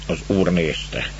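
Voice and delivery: ordinary delivery, nothing marked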